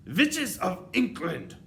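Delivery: slow, high, thundering witch cry; Grand High Witch roaring for attention; commanding shout. A narrator's reading. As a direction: bellow it slowly like a witch ruling her coven. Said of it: "shouted the Grand High Witch".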